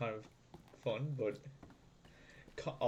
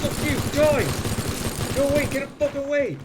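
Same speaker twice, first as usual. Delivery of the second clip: real alarm, shouting to drive it off; panicked shout; 11 semitones higher, 11 dB louder, faster than usual. Real, not acted. Player fending off an attacking Dalek, panicked shout. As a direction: yell it high and very loud.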